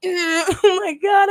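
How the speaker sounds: high, slow, stretched oh my God; replaying his own amazed reaction; amazed exclaim. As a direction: gasp it out in awe, drawn long.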